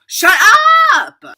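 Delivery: loud, high, excited shrieks of disbelief; excited shouting. Retold or imitated. Teen reliving winning concert tickets, high excited shouting. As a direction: scream it out in thrilled disbelief.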